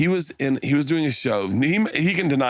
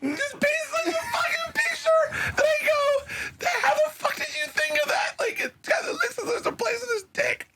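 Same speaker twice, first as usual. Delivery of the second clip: very high squeal through tearful laughter; helpless laughter; 3 dB quieter than usual. Real, not acted. Comic retelling his favorite quote, helpless laughter. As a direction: squeeze the words out while cracking up.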